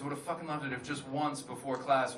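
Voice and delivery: ordinary talk, nothing marked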